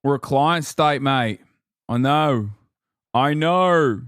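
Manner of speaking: a touch of Aussie caricature; mock Australian accent. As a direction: toss it off in fake Australian. Mock Aussie slang exchange.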